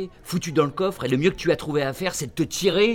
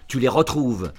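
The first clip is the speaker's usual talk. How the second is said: ordinary delivery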